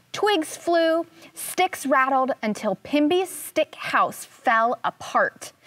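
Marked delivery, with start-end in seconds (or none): none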